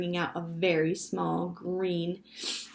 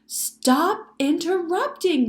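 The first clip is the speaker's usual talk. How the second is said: loud, slow, raised-pitch command; shouted order; 10 semitones higher, 8 dB louder, slower than usual. A narrator's reading. Father cutting off his interrupting children, shouting. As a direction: shout it slowly to stop the chatter. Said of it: "Mister Franklin shouted".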